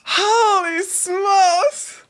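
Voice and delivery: high, very drawn-out exclamation; amazed exclamation. Real, not acted. Streamer recovering from laughing fit, long amazed exclamation. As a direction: stretch each word out, pitched high.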